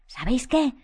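ordinary delivery, nothing marked

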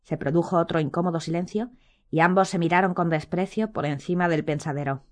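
plain reading voice

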